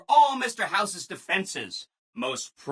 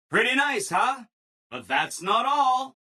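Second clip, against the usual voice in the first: ordinary talk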